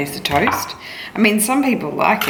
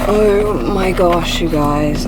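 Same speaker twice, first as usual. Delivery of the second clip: ordinary delivery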